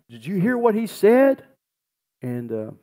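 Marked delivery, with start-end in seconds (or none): 0.1-1.4 much higher and louder, amazed; astonished question. Retold or imitated. Family at the deathbed asks each other, higher and amazed. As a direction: ask it like you can't believe your ears.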